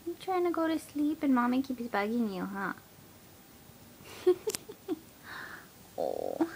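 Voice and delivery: ordinary talk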